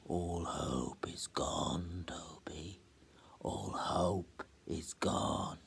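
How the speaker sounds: slow, hushed whisper of doom; ghostly whisper. Acted. The bells warn sleeping Toby in a slow whisper. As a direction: breathe it out like a tolling bell.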